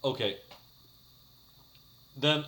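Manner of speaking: ordinary talk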